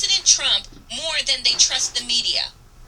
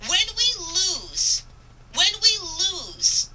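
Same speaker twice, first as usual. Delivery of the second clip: drawn out, slow and higher, each word weighed; slow emphatic repeat; 5 semitones higher, slower than usual. Real, not acted. Speaker warning her audience, slow weighty repetition. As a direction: let the phrase land slowly twice, gravely. Rallying speech on press freedom.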